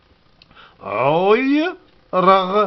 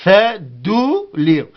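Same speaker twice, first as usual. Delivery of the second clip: slow demo; much slower than usual. Shown as a demonstration. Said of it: "c'est la salive".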